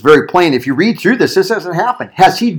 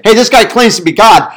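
quick, loud, raised accusation; tattling complaint; 7 semitones higher, 5 dB louder than usual. Retold or imitated. Accusers going to Pilate about Jesus, loud complaint. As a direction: report him like an indignant accuser. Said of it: "gone to Pilate and said".

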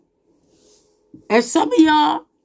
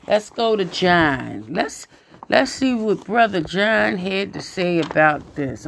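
normal talking voice

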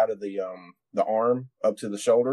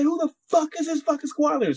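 high-pitched mock sergeant demand; high, outraged sergeant impression; angry first sergeant. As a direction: imitate an irate sergeant, voice pitched way up.

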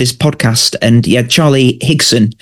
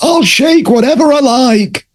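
very high, slow, put-on old man voice; cranky old man; 13 semitones higher, slower than usual. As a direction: squeak it out like a stubborn old codger. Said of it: "I love Brittis's response".

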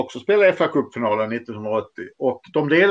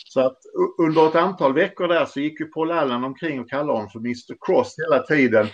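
ordinary delivery

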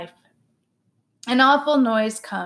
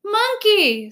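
high, loud terrified screech; cat screams at the sight of the gorilla; screaming cat; 12 semitones higher, 4 dB louder than usual. A narrator's reading. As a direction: shriek it in panic. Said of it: "screams the orange cat".

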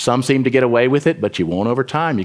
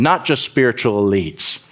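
ordinary talk, nothing marked

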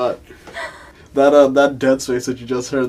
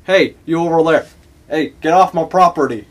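put-on Southern accent; 5 semitones higher, 7 dB louder than usual. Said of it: "I can't even do my southern accent".

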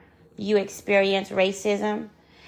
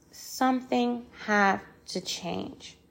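softer, drawn-out plea with gaps between words; weighty, slowed refrain; slow emphatic repeat; 6 dB quieter than usual. Real, not acted. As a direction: repeat it slowly and quietly, letting each word land.

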